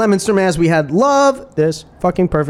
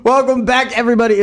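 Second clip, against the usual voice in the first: radio host; 7 semitones higher than usual. Acted. Host opening radio segment.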